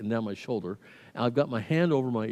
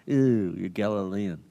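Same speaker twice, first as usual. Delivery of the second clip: mock disgust; 5 semitones lower, slower than usual. Mocks accuser's disgust at Galileans.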